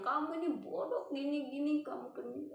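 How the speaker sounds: high-pitched mock insults; imitated scammer's abuse; retold cursing. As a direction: imitate an angry tirade without shouting. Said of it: "kata-kata kasar pun mulai bilang".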